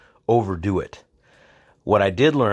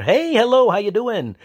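much higher, louder friendly hello; jovial greeting; 12 semitones higher, 5 dB louder than usual. Retold or imitated. Jovial cop greets the driver, high and loud. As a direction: greet him like an old pal. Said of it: "the more jovial".

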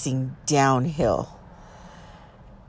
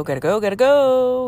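higher and louder panicked repeats; panicked urging; 8 semitones higher, 6 dB louder than usual. Retold or imitated. Fleeing the mountain, panicked higher urging. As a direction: push him to flee in panic.

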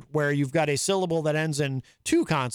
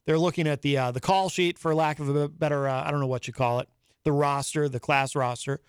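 ordinary talk, nothing marked

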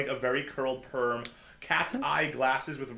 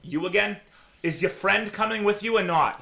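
higher, louder receptionist greeting; exasperated desk clerk, voice raised; annoyed receptionist; 6 semitones higher, 6 dB louder than usual. Acted. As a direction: challenge an unwelcome visitor from the desk. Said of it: "spots Bo and goes".

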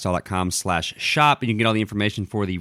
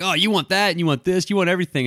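mock accusing; 7 semitones higher, faster than usual. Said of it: "and I'm like".

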